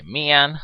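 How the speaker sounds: a lazy, high "Man"; drawled man. Retold or imitated. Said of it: "when you say".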